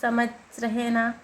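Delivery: usual speaking voice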